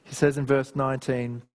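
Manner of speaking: ordinary talk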